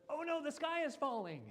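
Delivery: high, loud, mock alarm; hammed-up panic, like the sky is falling. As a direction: ham up a Chicken Little panic. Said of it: "kind of reactivity".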